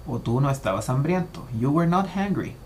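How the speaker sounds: normal talking voice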